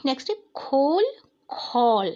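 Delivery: खोल with closed o, खौल with open au, loud; teacher contrasting the two vowels; vowel contrast demo. Shown as a demonstration. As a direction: set खोल against खौल clearly. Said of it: "ख पे ओ की मात्रा".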